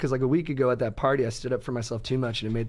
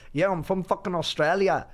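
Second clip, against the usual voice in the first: higher, put-on bad Australian accent; 5 semitones higher than usual. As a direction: imitate a woman badly faking an Australian accent. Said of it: "a bad accent".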